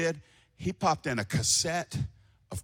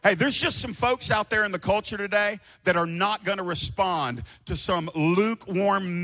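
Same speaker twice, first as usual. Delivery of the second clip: ordinary talk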